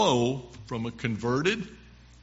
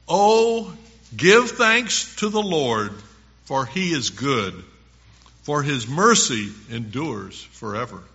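scripture reading; 5 dB louder than usual. Acted. Preacher calls listeners to give thanks to the Lord, proclaiming scripture. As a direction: proclaim it, big on the first phrase.

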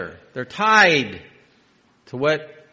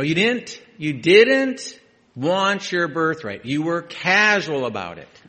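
rebuking; 5 semitones higher, 7 dB louder, slower than usual. Real, not acted. Preacher rebukes Esau.

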